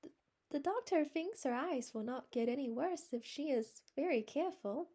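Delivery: high, hesitant faltering voice. A narrator's reading. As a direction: let the words stumble out, unsure. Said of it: "faltered Anne".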